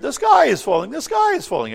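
high, loud, frantic yell; group yell. Acted. The panicked birds yell at Brucey Goosey, loud frantic yell. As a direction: cry it out all together. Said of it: "everyone yells".

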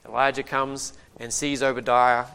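ordinary delivery

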